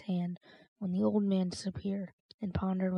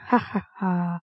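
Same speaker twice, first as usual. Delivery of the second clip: louder, deliberate acted laugh; mock chuckle; 6 dB louder, slower than usual. Old man bantering the boy, mock chuckle. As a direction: voice a staged chuckle, each ha separate.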